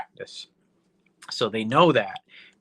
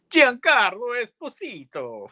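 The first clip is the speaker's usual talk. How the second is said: put-on Giancarlo Esposito announced much higher, Italian accent, big trailer flourish; Italian accent, credits style; 11 semitones higher, 4 dB louder than usual. Mimicking how the credits name the star, in a hammed-up Italian accent. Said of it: "I'm gonna say it with that Italian accent".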